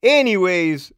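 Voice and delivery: forcing the story along loudly; loud anyways. Real, not acted. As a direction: snap back on topic. Repeats anyways louder.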